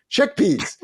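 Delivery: laughing through the words, high. Real, not acted. He laughs at his own chickpeas joke with the host. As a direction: chuckle out the punchline.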